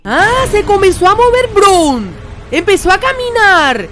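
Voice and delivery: loud, high-pitched frightened excitement; startled alarm. Real, not acted. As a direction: cry out as the thing starts moving. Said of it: "Me está asustando".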